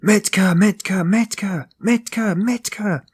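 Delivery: high repeated calls, loud then fading; bawling a name. Acted. Man bawls for Mitka down the stairs, high loud calls fading. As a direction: call out the name at the top of his voice. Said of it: "bawling at the top of his voice".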